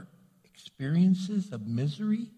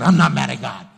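loud, defensive burst of denial; angry denial; 3 semitones lower, 11 dB louder than usual. Retold or imitated. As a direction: snap back, insisting you're not angry. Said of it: "you seem like you're mad at somebody".

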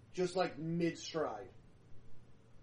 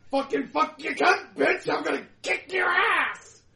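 much higher, much louder furious yelling; feral screaming rage; 13 semitones higher, 13 dB louder than usual. Acted. Feral vampire screaming curses at his opponent. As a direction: scream every curse like a rabid beast.

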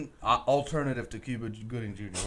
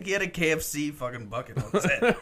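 usual speaking voice

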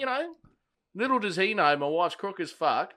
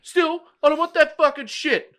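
high, loud, slow imitated shout; mock angry yell; 13 semitones higher, 8 dB louder than usual. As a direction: yell it back as the angry bloke, dragging the words. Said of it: "He yells this at me".

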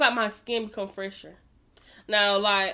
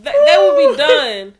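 high, loud, slow: they will be done; hammed-up declaration; emphatic yell; 17 dB louder, slower than usual. Real, not acted. Emphatic vow, drawn out.

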